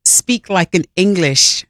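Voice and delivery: slow, careful attempt at an English accent; amateur English accent, unhurried. Shown as a demonstration. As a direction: try on an English gal's accent, drawn out. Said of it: "I wish I could have an English accent".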